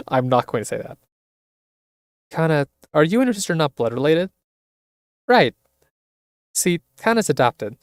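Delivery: ordinary talk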